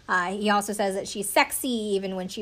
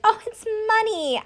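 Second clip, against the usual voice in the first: much higher, sing-song mock surprise; awkward joke; 11 semitones higher than usual. Contestant joking about his wallet, high awkward sing-song. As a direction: play up a clumsy joke. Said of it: "makes this really awkward joke".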